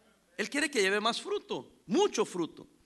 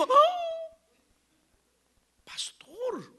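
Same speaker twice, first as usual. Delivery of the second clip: high breathy gasp of dismay; dramatic sigh; much slower than usual. Retold or imitated. Pastor voicing the offended brother, high whispered dismay. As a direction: act out the brother's shocked hurt.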